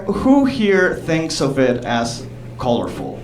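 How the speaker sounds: ordinary delivery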